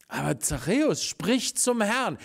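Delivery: ordinary talk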